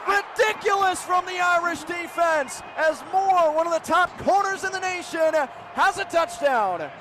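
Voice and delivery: high, loud shouting with a stretched name; excited pick six call. Real, not acted. Announcer shouting an interception return touchdown. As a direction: let it rip on the interception return.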